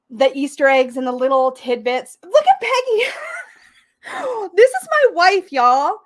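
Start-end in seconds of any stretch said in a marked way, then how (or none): 2.2-6.0 laughing loudly, pitched high; cracking up at her wife. Real, not acted. Laughing at wife's joke.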